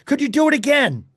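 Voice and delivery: high, loud call from the crowd; shouted question. Retold or imitated. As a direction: shout it across to him. Reporter calls out to Pierre.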